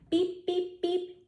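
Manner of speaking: high beeping truck horn; playful toy horn beeps. Pretend. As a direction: beep like a little truck. Truck horn in picture book.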